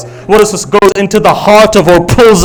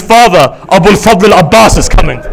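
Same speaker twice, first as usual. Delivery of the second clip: urgent retelling, building in pitch to full voice; impassioned sermon narration; 5 semitones higher than usual. Acted. Preacher telling of Abbas riding to Hur, urgent and building.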